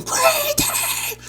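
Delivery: very high yelled "Green team!"; high pitched retold scream; screamed team call. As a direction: call out the team name while running across the map. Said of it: "'Cause I ran and screamed".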